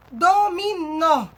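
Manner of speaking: dominó slow, syllable by syllable, strong final nó; stress on NÓ. Shown as a demonstration. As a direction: spell out dominó in loud drawn syllables, landing on nó. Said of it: "Sílaba tônica é um nó".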